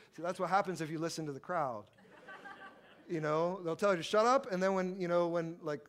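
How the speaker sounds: ordinary talk, nothing marked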